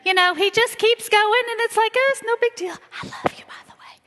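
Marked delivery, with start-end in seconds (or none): 2.0-3.9 high, breezy "no big deal", then whispering the I love you; casual husband impression. Husband slipping I love you in offhand, breezy then whispered. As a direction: toss it off lightly, then whisper the last part. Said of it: "he just kindly just slides that in".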